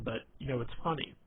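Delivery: ordinary talk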